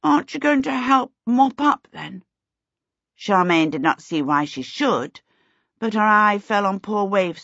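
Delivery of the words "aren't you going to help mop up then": higher-pitched, plaintive asking; a pitiful little request, fading at the end; pathetic plea. A narrator's reading. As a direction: ask for help rather pathetically. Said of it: "rather pathetically".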